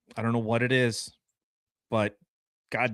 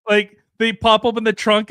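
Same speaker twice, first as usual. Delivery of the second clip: ordinary talk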